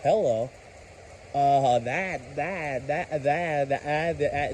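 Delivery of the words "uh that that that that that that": stuck repeating the same word; real stutter after a scare. Real, not acted. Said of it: "it's got me stuttering".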